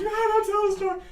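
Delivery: high, loud pleading; shrill plea. Acted. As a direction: beg in a shrill panic. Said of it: "they're like".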